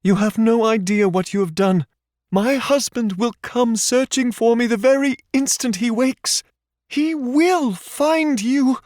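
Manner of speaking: Paige's high defiant warning. A narrator's reading. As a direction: warn him her husband will come. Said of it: "she warned".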